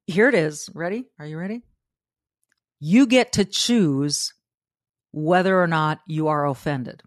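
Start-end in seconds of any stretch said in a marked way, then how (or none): none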